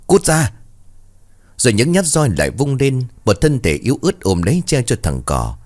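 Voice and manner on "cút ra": sharp, higher angry shove-off; snapping. A narrator's reading. Mistress kicking the maid away, sharp angry yell. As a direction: spit it out like a kick. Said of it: "hất mạnh nó ra rồi bảo".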